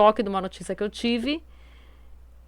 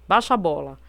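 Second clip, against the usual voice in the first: normal talking voice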